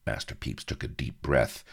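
ordinary delivery

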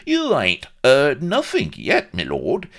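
loud, high-pitched Cockney protest; indignant. A narrator's reading. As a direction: press the case with Cockney outrage. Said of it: "cried Vladimir indignantly".

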